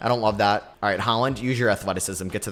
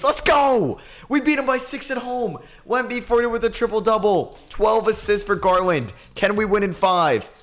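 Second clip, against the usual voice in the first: excited cheer; 9 semitones higher than usual. Real, not acted. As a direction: celebrate the win at full voice. Celebrating a playoff win.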